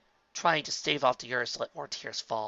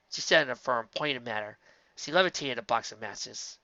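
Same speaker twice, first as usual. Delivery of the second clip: ordinary delivery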